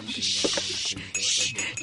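soft unvoiced shushing; hushing. Mother hushing the family before father speaks, softly. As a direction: hush the room with a breathy shh.

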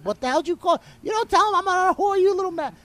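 high, loud, fast mock screech of an angry mother; hammed-up outraged mom; mother furious. Furious mother voiced in story.

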